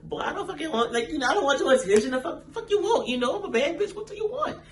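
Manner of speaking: very high-pitched mock brush-off with laughter; sassy squeal, playing hard to get. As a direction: act uninterested in a high, giggly put-on voice. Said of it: "I'ma play it off like".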